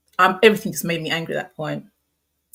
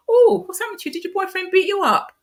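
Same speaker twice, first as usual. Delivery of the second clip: much higher and louder, playful mock concern; jokey teasing tone; 6 dB louder than usual. Colleague jokingly teasing her at work, high playful voice. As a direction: tease a colleague about her swollen lip. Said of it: "she said in a very jokey tone".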